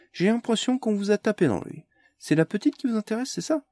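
high, teasing provocation; mocking taunt. Carmalière taunting the hooded strangers, high and teasing. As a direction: needle the strangers, sing-song high.

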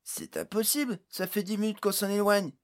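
high-pitched, loud character voice; incredulous exclamation. A narrator's reading. Pod after minutes of descending, exclaiming in disbelief. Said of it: "s'exclama Pod".

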